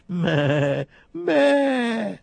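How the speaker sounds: loud high bleating meh; high sheep bleat. Acted. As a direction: bleat like a lamb. Sheep shows how it bleats.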